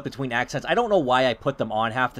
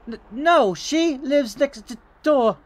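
high, loud stammering character voice; nervous neighbor; 11 semitones higher, 4 dB louder, slower than usual. Acted. Neighbor redirects the caller next door, high nervous stammer. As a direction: stumble over the words in a high voice. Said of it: "I don't know why I'm giving them accents".

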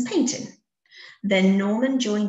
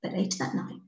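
ordinary delivery

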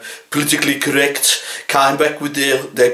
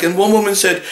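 ordinary talk, nothing marked

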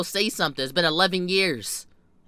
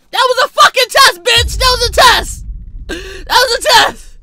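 very loud, high-pitched outburst; exploding at the top of the voice; outraged yelling; 10 dB louder than usual. Acted. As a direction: scream it in comic outrage. Outraged outburst at her fake offer.

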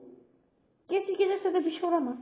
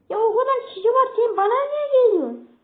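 higher and louder, fed up at the enemy; exasperated shout; 7 semitones higher, 6 dB louder than usual. Real, not acted. Gamer shouting at enemy chasing him, exasperated and loud. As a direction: yell at the player chasing you.

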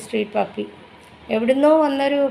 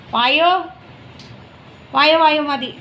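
calling the dog; 5 semitones higher, 4 dB louder than usual. Real, not acted.

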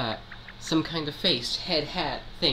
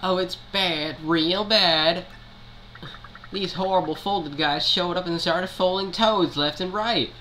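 lightly voiced Toad; 5 semitones higher than usual. Reading Toad dialogue aloud.

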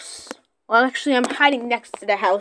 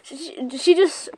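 normal talking voice

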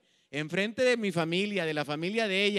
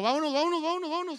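laughing urgent hurry; 9 semitones higher, faster than usual. Retold or imitated. Hurrying friends away, laughing.

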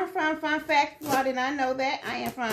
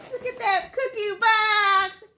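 higher and louder, slow and gushing; delighted; 8 semitones higher, 6 dB louder, slower than usual. Real, not acted. Showing viewers the cookie box, delighted and gushing. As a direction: gush over the cute box.

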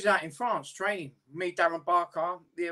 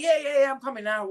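higher, brighter cheer to hide the crying; faking normal; 8 semitones higher, 5 dB louder than usual. Retold or imitated. Boxer answering teammates at the door, pitched-up fake cheer. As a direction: act fine right after sobbing. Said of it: "walk out like if it was normal".

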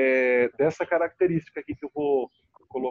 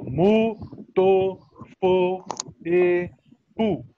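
"Mu, to, fo, e, pu" each punched out alone, louder; isolated stressed syllables; 2 semitones higher, 4 dB louder, much slower than usual. Shown as a demonstration. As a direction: hit "Mu, to, fo, e, pu" as separate strong beats. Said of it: "Todas têm acento". ("Mu, to, fo, e, pu" em sílabas tônicas fortes e separadas)

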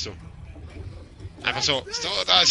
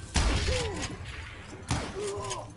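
high triumphant laugh; 5 dB quieter than usual. Real, not acted. Triumphant after the final kill.

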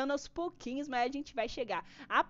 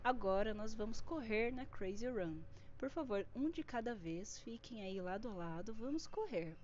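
mock well-behaved streamer; 3 semitones lower, 6 dB quieter than usual. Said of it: "É assim, gente, é super comportado".

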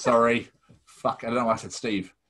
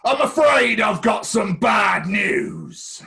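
loud, slow Lancashire wrestling heel, pitched up; Bad News Barrett; 10 semitones higher, 8 dB louder, much slower than usual. Addressing Jamie as the wrestler, a loud slow Lancashire announcement. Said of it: "it was a proper Lancashire accent".

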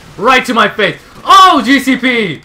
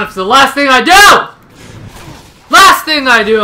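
vengeful vow; 5 semitones higher, 6 dB louder than usual. Real, not acted. Vowing revenge on player.

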